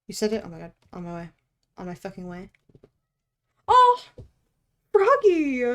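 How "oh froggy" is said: high, loud excited outburst; high excited cry, falling away; delighted squeal. Real, not acted. Squeals at sight of frog.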